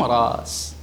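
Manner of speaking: "راس" low, with a long aa; hamza softened to alif. Shown as a demonstration. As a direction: draw out "راس" without a hamza. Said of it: "ماذا تقول فيهما؟". (نطق «راس» بالمد دون همز)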